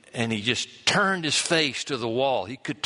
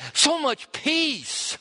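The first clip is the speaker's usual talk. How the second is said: peaceful; much slower than usual. Acted. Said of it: "so easy to be with".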